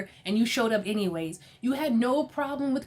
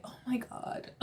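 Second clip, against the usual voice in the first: whispered aside; 3 semitones higher, 11 dB quieter than usual. Real, not acted. Aside at Sutton crying.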